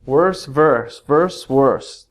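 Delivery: "Worse, verse" said slowly, each with a falling tune to show the rhyme; teacher drilling "Worse, verse, verse, worse"; rhyming words modelled. Shown as a demonstration. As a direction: contrast "worse" and "verse" by their shared ending /ə˞s/. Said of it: "Those words rhyme".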